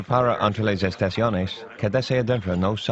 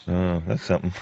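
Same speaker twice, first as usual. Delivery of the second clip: ordinary talk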